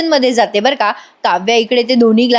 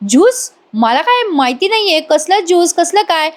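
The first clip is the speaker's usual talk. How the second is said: higher-pitched, play-acted cluelessness; feigned innocence; 6 semitones higher than usual. Accused woman denying the papaya juice, feigned innocence. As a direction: act as if this is all news to you. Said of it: "रम्या असं दाखवतेय जणू काही त्या गावचीच नाहीये".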